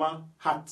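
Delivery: "hat" with an open French "ah" vowel; French-style A. Shown as a demonstration. Teacher showing how H A T sounds, French-style A. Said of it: "on prononce ça comme".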